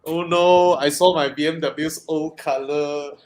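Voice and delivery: normal talking voice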